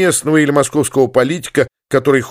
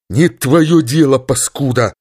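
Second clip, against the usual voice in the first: cutting off. Acted. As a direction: shut her down sharply. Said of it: "оборвал её". (резко обрывает)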